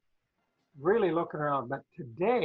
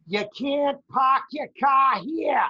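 put-on slow, higher, loud Boston-accented shout; imitated Boston yell; 7 semitones higher, 6 dB louder, slower than usual. Retelling a market worker yelling at a guy, in a loud, slow Boston accent shout. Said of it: "yell at a guy".

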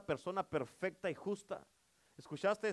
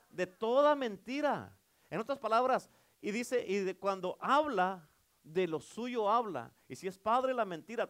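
preaching at full force; 3 semitones higher, 6 dB louder than usual. Acted. Preacher warning church the enemy only lies, full force. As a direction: hammer home every phrase.

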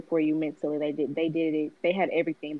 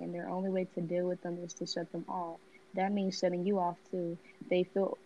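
reading aloud; 6 dB quieter than usual. Acted. Reads a passage aloud.

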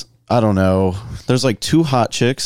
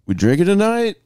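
hyped up question; 10 semitones higher than usual. Retold or imitated. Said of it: "I was like".

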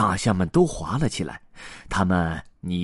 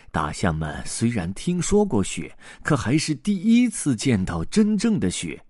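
ordinary delivery, nothing marked

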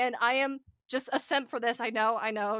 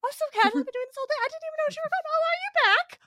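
high, loud, giddy fake-casual chatter; giddy Rumpelstiltskin; 13 semitones higher, 4 dB louder than usual. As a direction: gush while pretending to be casual. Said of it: "he's just spinning".

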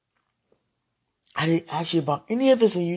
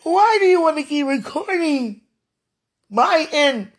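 loud, high, anguished cry; anguished outcry; 9 semitones higher, 6 dB louder than usual. Real, not acted. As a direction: cry out in protest.

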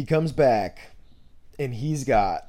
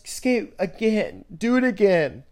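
higher, louder imitated kid's demand; upset kid; 8 semitones higher, 6 dB louder, slower than usual. Kid begs skater not to leave, imitated upset demand. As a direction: insist like an upset little kid. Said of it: "Like, he was upset".

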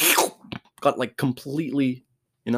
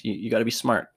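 usual speaking voice

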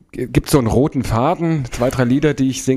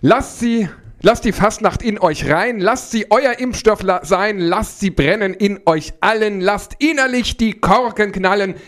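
rhyming festive stage verse; carnival rhyme recital; 7 semitones higher, slower than usual. Pretend. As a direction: declaim it like a carnival speaker on stage.

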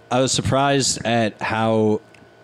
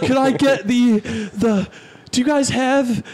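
flustered customer; 13 semitones higher, slower than usual. Retold or imitated. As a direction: stammer like a man caught off guard. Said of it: "He's like".

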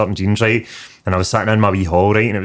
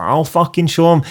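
eager hard man; 8 semitones higher than usual. Retold or imitated. Big pupil volunteers to show him.

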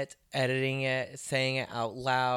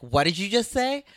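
a hint of a stern substitute teacher, pitched up; angry substitute; 6 semitones higher, 3 dB louder than usual. Comic snapping at a heckler like a substitute teacher. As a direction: scold the crowd like a cross sub. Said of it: "You sound like an angry substitute".

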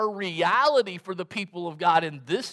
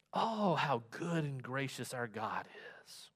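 lower, much softer, in quiet wonder; awed and hushed; 7 semitones lower, 10 dB quieter than usual. Real, not acted. Preacher marvelling to the congregation, soft and hushed. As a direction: sigh it out in reverence. Awed aside about God's grace.

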